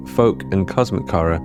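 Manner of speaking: ordinary delivery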